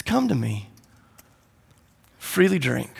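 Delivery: soft, low, unhurried welcome; hushed invitation. Acted. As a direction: invite them quietly, with space between phrases. Jesus gently invites the crowd.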